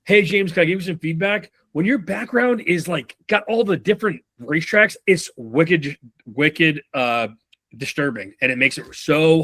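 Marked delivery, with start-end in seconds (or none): 0.0-3.8 mock feedback opener. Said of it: "me going".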